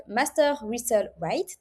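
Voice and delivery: English words said with a French accent, slow. Real, not acted. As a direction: spell out the English term slowly in a French accent. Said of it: "désolée pour mon accent anglais".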